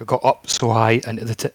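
ordinary talk, nothing marked

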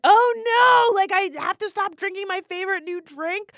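high, loud "oh no" alarm; mock panic. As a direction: wail like an alarmed reader of clickbait. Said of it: "you're gonna be like".

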